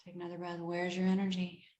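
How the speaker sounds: soft, inward self-soothing question; said to oneself. Retold or imitated. Sleepless woman calming herself with eyes closed, soft and inward. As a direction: murmur it calmly to yourself. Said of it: "I would just say it to myself with my eyes closed".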